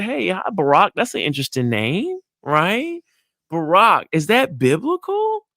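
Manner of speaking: bright, high, curious chat-up; interested greeting. Shown as a demonstration. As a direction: lean in with playful interest, pitched high. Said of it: "You see what I'm doing?".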